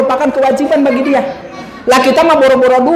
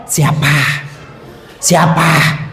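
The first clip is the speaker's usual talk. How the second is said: lazy dog voice; 8 semitones lower, 4 dB quieter, much slower than usual. Acted.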